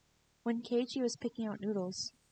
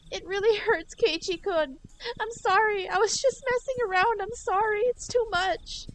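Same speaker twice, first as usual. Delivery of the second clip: much higher and louder, apologising through pain, slowing at the end; pained pleading; 14 semitones higher, 7 dB louder than usual. Acted. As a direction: beg for mercy in a high, hurting voice.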